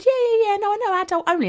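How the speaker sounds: high, eager and innocent; naive eager self. Retold or imitated. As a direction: chirp it like you have no clue. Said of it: "I said".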